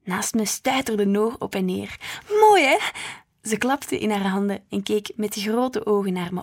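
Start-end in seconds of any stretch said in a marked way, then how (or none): 2.4-2.8 high, loud and thrilled; little sister delighted by Christmas lights, high and loud; excited. A narrator's reading. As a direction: burst out in delight like a bouncing six-year-old. Little sister bouncing with joy.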